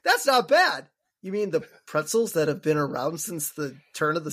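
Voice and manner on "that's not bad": high, louder mock-amazed echo; mocking Marge. Host mocks Marge's pretzel line, mock-amazed. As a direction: parody her wide-eyed first taste. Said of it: "Has Marge never had a pretzel".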